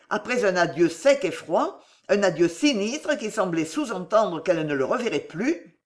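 plain reading voice

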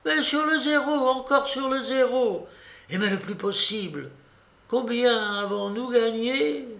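put-on high-pitched, breathless old lady's urging; impatient, feverish grandmother; excited old babouchka, urgent. A narrator's reading.

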